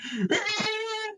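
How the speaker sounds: high, soft mock wail; imitated child crying. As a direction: mimic a choking kid's cry. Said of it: "crying. He's like".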